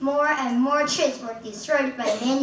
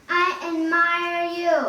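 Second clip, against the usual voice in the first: mild scream; 5 semitones higher than usual. A narrator's reading. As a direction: call it out with understated force. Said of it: "he screams to the mountains".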